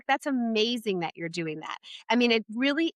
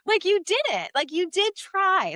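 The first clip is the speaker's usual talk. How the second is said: put-on praising child; 9 semitones higher than usual. Shown as a demonstration. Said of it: "important to say".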